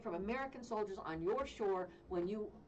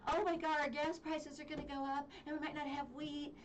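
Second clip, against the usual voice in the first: higher, whiny mock fretting; mock whiny Italians; 6 semitones higher than usual. Mocking Italians who fear gas prices, whiny and higher. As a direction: whine it like a spoiled coward.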